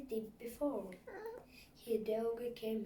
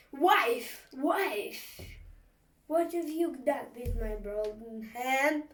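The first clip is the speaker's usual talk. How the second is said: loud, higher roaring call; enraged giant yelling; furious ogre bellow; 3 semitones higher, 11 dB louder, slower than usual. Acted. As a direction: bellow for the wife in a rage. Ogre bellows for his wife.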